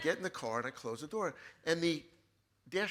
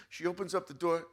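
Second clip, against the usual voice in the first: normal talking voice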